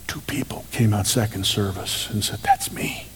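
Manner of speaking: a soft unvoiced aside, barely breathed; whisper. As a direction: lean in and whisper it to them. Quiet aside about listeners.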